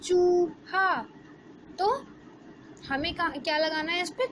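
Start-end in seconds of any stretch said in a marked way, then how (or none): 0.0-1.0 चूहा with a long stretched ऊ; long oo in chooha. Shown as a demonstration. Teacher to class, showing the right long ऊ. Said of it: "बड़े ऊ की मात्रा".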